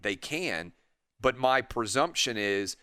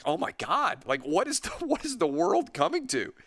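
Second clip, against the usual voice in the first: much higher, a laugh breaking in, mock outrage at the world; exasperated disbelief; 8 semitones higher than usual. Patient reacting to a preachy dentist, mock-exasperated high disbelief. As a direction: throw your hands up at the absurdity, voice climbing. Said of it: "would you be like".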